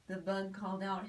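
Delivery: plain reading voice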